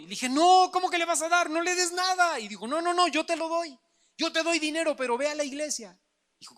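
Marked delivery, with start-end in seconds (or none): 0.3-2.4 high, loud indignant objection; indignant protest. Retold or imitated. Hijo protesta ante la mamá. 2.7-6.0 high, loud insistent mother; a mother overruling her son, loud and high. Retold or imitated. Mamá insiste en dar dinero.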